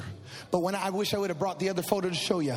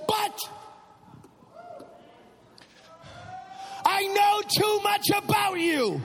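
defiant cry; 10 semitones higher, slower than usual. Acted. Praying, defiant cry to God.

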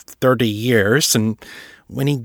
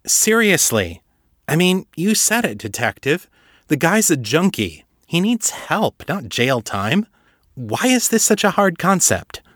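put-on higher, insistent young woman arguing her case; pleading Misty; 7 semitones higher than usual. Misty urges the council to try rehab, higher and insistent. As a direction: push the point, exasperated and earnest.

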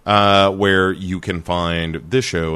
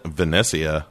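put-on lower, softer Mediterranean-accented echo of the name; mock Mediterranean accent; 4 semitones lower, 6 dB quieter than usual. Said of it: "somehow made you more Mediterranean".

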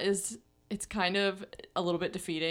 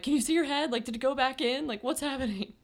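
anxious; 7 semitones higher than usual. Retold or imitated. Anxious questions to midwife.

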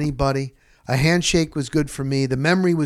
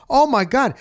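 amazed; 10 semitones higher, 3 dB louder than usual. Retold or imitated. Amazed at old team photos.

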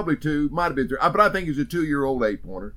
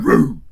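loud gunshot boom; 8 dB louder than usual. Retold or imitated. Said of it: "I went".

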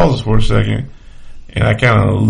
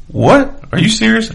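high, incredulous squeak of disbelief; shocked disbelief; 13 semitones higher, slower than usual. Retold or imitated. Startled coworker, told he'll be shot, squeaks in disbelief. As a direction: go way up in pitch, can't believe it. Stunned guy's disbelieving reply.

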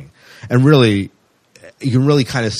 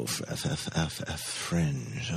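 near-silent, slowed-down sounding out of the letter F; scanning the shelf under your breath; breathy hush while hunting for a title; searching mutter; 6 semitones lower, 17 dB quieter, slower than usual. Acted. Hunting along the DVD shelf.

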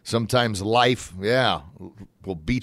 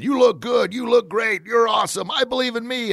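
put-on high cheering, like riled-up kids; pumped-up praise; 12 semitones higher than usual. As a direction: cheer them on at high pitch. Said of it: "it's making everybody feel good".

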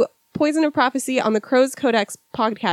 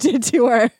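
ordinary talk, nothing marked

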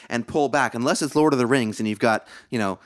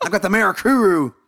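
higher, louder hammed-up declaration; mock outburst; 10 semitones higher, 5 dB louder than usual. Coworker bursting out in a meeting, hammed-up shout. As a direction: blurt it out at the office as a mock threat. Blurting it in a conference room.

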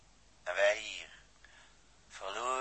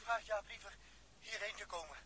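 short of air; 9 semitones higher, 9 dB quieter than usual. Acted. Running out of air, urgent.

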